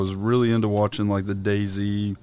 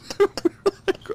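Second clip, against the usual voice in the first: high laugh. Real, not acted. Laughing at Dumpy.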